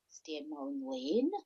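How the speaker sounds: incredulous question, pitch leaping up; puzzled, rising to a high mock protest; mock bafflement. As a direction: act out a baffled comeback. Acts out her baffled retort.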